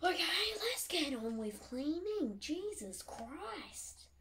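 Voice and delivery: high, drawn-out mock exasperation; hammed-up wail at yourself, stretching the words; exasperated self-nagging. Scolds herself for not cleaning.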